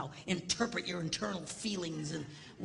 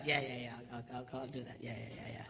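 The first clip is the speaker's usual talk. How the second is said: much lower, quieter brush-off mumble; dismissive yeahs; 10 semitones lower, 8 dB quieter than usual. Retold or imitated. Husband brushes off wife's nagging about spiders, low dismissive mumble. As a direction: fob her off half-heartedly. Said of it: "she was on me for weeks".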